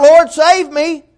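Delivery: high, loud, slow plea; loud cry. Retold or imitated. Peter sinking, crying loudly to the Lord. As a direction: shout for rescue. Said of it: "He called out".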